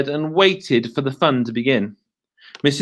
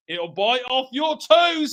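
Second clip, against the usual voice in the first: menacing husband; 10 semitones higher, slower than usual. Acted. Mr Twit scaring wife.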